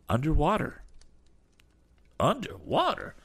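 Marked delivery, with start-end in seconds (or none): 2.2-3.2 very high-pitched incredulous question; astonished echo. Acted. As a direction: repeat it in high-pitched disbelief. Damon echoes it in astonishment.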